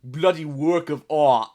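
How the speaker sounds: slow, loud mock-British voice; British accent. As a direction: lay on a thick comic British accent. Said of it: "British people be like".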